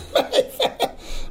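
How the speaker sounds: high, airy burst of laughter; amused, teasing high laugh; mocking laugh. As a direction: laugh at their fancy choice. Laughs at the Mayfair wedding venue.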